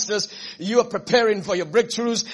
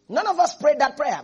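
ordinary talk, nothing marked

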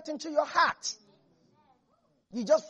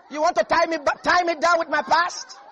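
defiant Rahab; 5 semitones higher, 6 dB louder than usual. Acted. Rahab voiced defiantly in sermon.

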